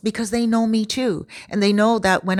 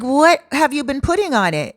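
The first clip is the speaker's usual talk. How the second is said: alarmed question; 7 semitones higher than usual. Retold or imitated. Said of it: "And I'm like".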